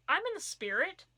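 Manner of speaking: indignant. Acted. Reader protests in disbelief.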